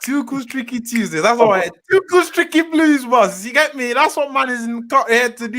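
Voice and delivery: high-pitched hype-man patter; hype merchant. Real, not acted. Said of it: "I'm a hype merchant".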